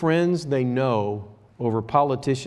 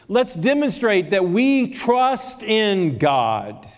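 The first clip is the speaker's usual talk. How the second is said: raised, fervent preaching voice; 6 semitones higher, 4 dB louder than usual. Acted. Pastor exhorting his congregation, fervent preaching. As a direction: preach it out to the church.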